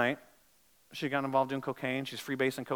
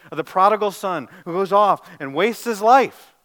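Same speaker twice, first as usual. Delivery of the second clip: raised, pressing sermon voice; urgent preaching; 3 semitones higher, 11 dB louder than usual. Acted. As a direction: push the congregation to repent. Preaching at full force.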